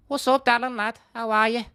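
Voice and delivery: very high comic Scouse; Scouse accent. Shown as a demonstration. Streamer greeting in a requested impression, high-pitched Scouse. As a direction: push the Liverpool accent right up high. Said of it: "Scouse impression".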